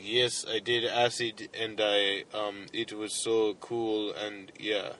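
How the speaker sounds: slow, halting imitation of a dopey typer; grunt talk. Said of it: "I have to talk like grunt".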